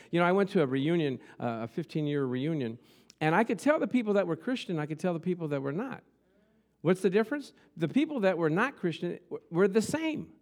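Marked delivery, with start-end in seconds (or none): none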